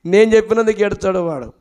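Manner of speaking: ordinary talk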